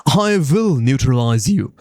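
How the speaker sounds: normal talking voice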